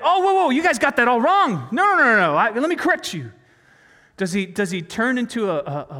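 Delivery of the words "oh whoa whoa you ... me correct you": high, hurried mock protest, starting loud; mock backtracking. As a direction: act out a panicked correction, cutting in loudly. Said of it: "He's like".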